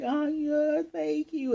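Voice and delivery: put-on very high, touched little-girl voice; squeaky, hammed-up tearful thanks; imitated teary gratitude. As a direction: act out a moved child near tears. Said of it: "gets the little teary eyes".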